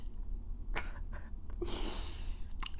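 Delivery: breathless, voiceless wheezing laugh; helpless laugh. Real, not acted. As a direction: laugh until no sound comes out. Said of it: "it has me cracking up".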